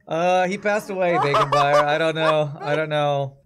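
loud helpless laughter; can barely talk for laughing. Real, not acted. Laughing hard at clip.

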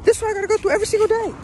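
high, loud, rapid-fire complaint; exasperated yell. Real, not acted. Dog owner venting about the daily ordeal, exasperated yell. Worn out shouting while handling dog.

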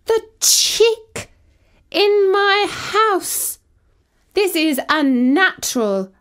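high, outraged cat protest; indignant protest. Acted. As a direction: splutter in offended outrage. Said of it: "I protested to Eamon".

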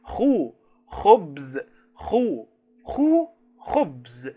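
teacher chanting خو then خبز; phonics drill. Shown as a demonstration. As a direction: sound out خو and خبز for children, the final pair slow. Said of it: "أنا سامع حد بيقول لي خبز".

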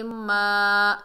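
मा drawn out steadily on a level pitch; elongated vowel. Shown as a demonstration. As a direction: hold the vowel of मा long. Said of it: "एक अलिफ की मिकदार खींच कर पढ़ेंगे".

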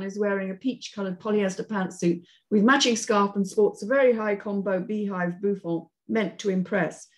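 usual speaking voice

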